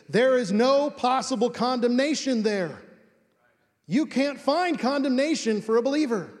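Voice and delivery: high, emphatic preacher's proclamation; Donald Gray Barnhouse. Retold or imitated. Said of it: "I'm bringing one out for him".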